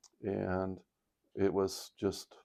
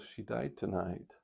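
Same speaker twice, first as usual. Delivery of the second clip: soft, slow, grieving delivery; real emotion; 10 dB quieter, slower than usual. Real, not acted. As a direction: break the news softly, holding back emotion. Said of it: "I got a little emotional there".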